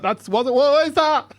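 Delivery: high, slow horrified question; shocked. Retold or imitated. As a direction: recoil at what you see, drawn out. Alarmed at the birth sight.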